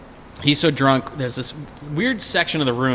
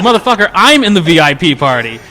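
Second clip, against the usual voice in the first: mock outrage; 6 semitones higher, 8 dB louder than usual. Angry friend on the phone.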